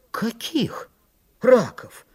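indignant high-pitched cry; stung yell. A narrator's reading. Митька reacts to a slip about crayfish, stung high yell. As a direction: yelp it as if stung. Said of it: "завопил, как ужаленный Митька".

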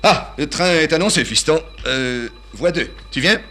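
put-on high, loud, bustling old father; father calling his son to catch the train, high and loud; fussy old father. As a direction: burst in hurried and shrill-pitched, calling his son. Father bursts in with harp.

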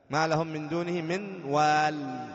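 slow recitation ending on "وال" without the ya; Quranic pausal recitation. Shown as a demonstration. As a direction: recite slowly and stop on the l. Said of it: "وقفت على حذف الياء". (تلاوة بطيئة مع الوقف على "وال" بحذف الياء)

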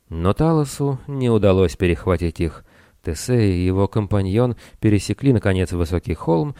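ordinary delivery, nothing marked

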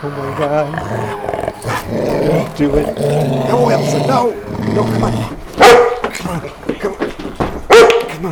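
high, loud frantic cries; panicked shouting. Acted. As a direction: scream in terror and run. Sees an attack, flees with dog.

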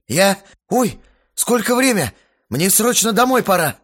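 high, loud hurried excuse; flustered panic. Acted. Frightened guy makes excuses to flee, loud and flustered. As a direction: blurt out a way to escape.

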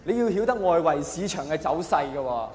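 ordinary delivery

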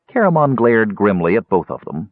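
plain reading voice

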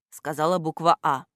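plain reading voice

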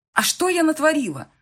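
high, loud defensive retort; bristling. A narrator's reading. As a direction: flare up at the accusation. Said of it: "вскинулась я". (вскинувшись, возмущённо)

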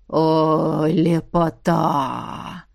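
low, drawn-out gasp of admiration; admiring old tsar. A narrator's reading. Tsar admiring the painted girls, slow low delight. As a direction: savour it slowly in a deep voice.

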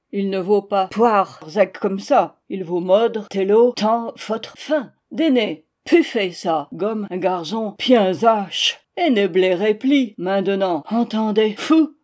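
put-on loud, halting German-accented admonishment; gruffly consoling angel. Angel soothing the tearful host, gruffly consoling creature. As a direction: console bossily in mangled French.

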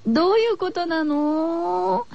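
high, drawn-out, half-laughing fright; slow scared whine; 怖がって笑いながら; spooked. Real, not acted. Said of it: "やだ".

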